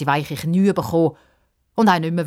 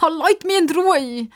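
high, drawn-out wail; distressed, high-pitched outburst; upset cry; 13 semitones higher, 3 dB louder than usual. A narrator's reading. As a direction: cry out to be left alone. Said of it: "ruft Pippa und rennt davon".

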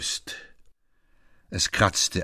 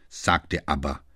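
usual speaking voice

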